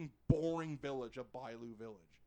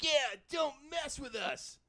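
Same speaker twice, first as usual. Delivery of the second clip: higher, slow mock-tough thug; gloating thug voice; 11 semitones higher, slower than usual. Thug gloats over the beaten hero, slow and higher. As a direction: gloat like a cartoon bully.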